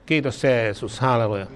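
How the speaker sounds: ordinary delivery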